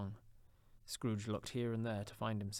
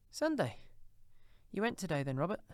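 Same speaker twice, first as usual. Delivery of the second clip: surprised wife; 8 semitones higher than usual. A narrator's reading. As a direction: query it in a high woman's voice. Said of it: "said his wife".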